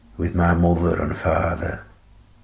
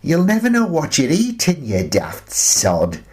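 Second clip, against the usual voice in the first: higher and quick, teasing scold; daughter's voice; 9 semitones higher than usual. Acted. Old man voicing Shannon scolding him fondly. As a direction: tease him like a fond daughter. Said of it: "she says".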